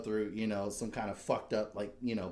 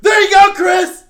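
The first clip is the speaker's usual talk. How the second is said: much higher, much louder gleeful yell; triumphant shout; 22 dB louder than usual. Pretend. Husband taunts host after flipping his table, triumphant shout. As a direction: bellow it across the room, laughing.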